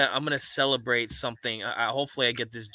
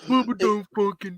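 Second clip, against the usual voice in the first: impression; 8 semitones higher, 4 dB louder than usual. Imitates Bubba's voice too.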